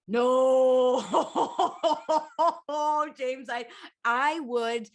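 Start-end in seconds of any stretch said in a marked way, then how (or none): 0.1-1.0 loud, drawn-out protest; long, loud "no"; horrified no. Real, not acted. Recoils from mention of a surprise. 1.0-3.1 long laugh bursting out after the shout; loud, rolling laughter; big laugh. Real, not acted. Laughs at the threatened surprise.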